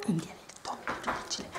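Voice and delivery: whisper. Real, not acted. Whispered aside.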